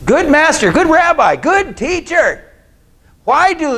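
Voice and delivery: butter him up, then stick the knife in; mock flattery. Mocking the Pharisees' flattery.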